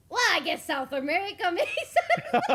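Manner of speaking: loud, laughing concession; words dissolving into giggles; breaking into laughter. Real, not acted. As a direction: crack up as you reason it out. Cracking up at her own mistake.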